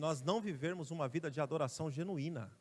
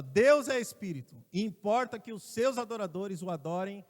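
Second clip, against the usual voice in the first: loud scripture proclamation; 3 semitones higher, 9 dB louder than usual. Acted. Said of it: "Aí ele fala assim, ó".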